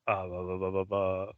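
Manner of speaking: normal talking voice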